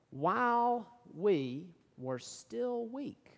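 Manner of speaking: slow, drawn-out scripture reading. Shown as a demonstration. Preacher reading Romans aloud to congregation, very slow. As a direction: lay each word down slowly.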